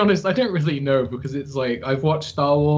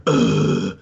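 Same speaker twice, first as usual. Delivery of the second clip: put-on edgy teen; 5 semitones lower, 4 dB louder than usual. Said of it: "like, a really edgy teen".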